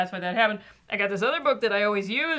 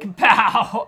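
loud kapow; 10 dB louder than usual. Pretend. Reading sound effect aloud.

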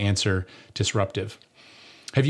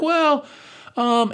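nervous hesitation. Retold or imitated. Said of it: "I was like".

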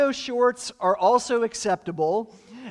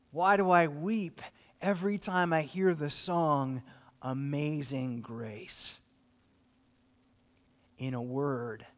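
soft sincere; 9 semitones lower, 9 dB quieter than usual. Real, not acted. Emotional climax on freedom.

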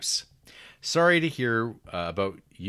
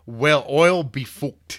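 loud, slow Irish accent on a higher pitch; 6 semitones higher, 12 dB louder, slower than usual. Shown as a demonstration. Host demonstrating the trick phrase to his co-host, loud Irish accent. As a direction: belt it out in a broad Irish accent. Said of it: "approximated an authentic Irish accent".

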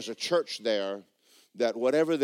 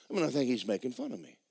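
ordinary talk

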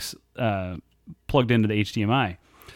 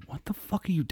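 whispered, higher, stunned; shocked whisper; 5 semitones higher, 10 dB quieter than usual. Retold or imitated. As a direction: hiss it under your breath in disbelief. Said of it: "I go".

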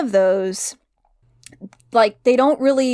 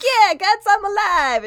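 put-on high-pitched, louder kid's answer; mock kid's reply; 11 semitones higher, 4 dB louder than usual. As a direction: answer back as the kid, voice pushed up high. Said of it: "it's just like".

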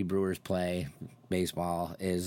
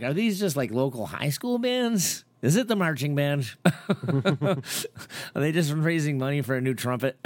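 higher, mock-incredulous string of questions; 7 semitones higher, 5 dB louder than usual. Imitating himself doubting the cheap festival, incredulous. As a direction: pile on the joking disbelief. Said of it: "and then I'm like".

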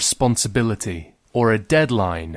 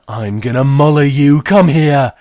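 loud playful threat; 4 semitones higher, 9 dB louder than usual. Acted. Girl threatens her twin playfully, loud. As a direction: threaten her as you pounce.

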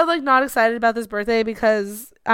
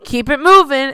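loud brusque line attendant; mock screaming; 11 dB louder than usual. Acting out clerk moving a long line along, mock yell. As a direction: call it out loudly to the queue. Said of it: "I feel like you're screaming at these people".

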